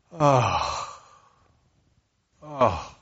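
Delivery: heavy, drawn-out exasperated sigh; deep sigh. Shown as a demonstration. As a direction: demonstrate a big sigh on an exhale. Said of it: "Something made you go".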